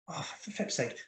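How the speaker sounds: whispered, voiceless mock of a council official; mock Watcher council voice. Reviewer mocking how council people talk, whispered. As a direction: hush it out like a stuffy council member. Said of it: "talk like this".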